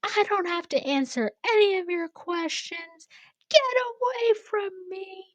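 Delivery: very high-pitched mock yelling; imitated yelling. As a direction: mimic her yelling in a squeal. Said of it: "she's yelling".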